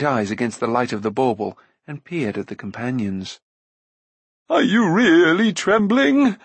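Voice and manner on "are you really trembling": high, loud, slow quavering question; anxious giant. A narrator's reading. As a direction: ask eagerly for reassurance, voice wobbling. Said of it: "he asked in an anxious voice".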